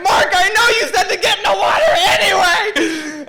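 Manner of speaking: high, loud wailing mock argument; shrill cry. Storyteller blaming his friend amid burning pain, shrill yell. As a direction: shriek back as if in agony. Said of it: "hearing our shrill cries from above".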